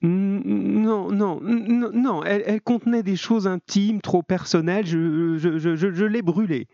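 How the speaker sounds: high, panicky woman's voice; nervous, stuttering denial pitched high; flustered stammering. A narrator's reading. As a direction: stumble over the words while inventing an excuse. Cornered woman lies to cousin.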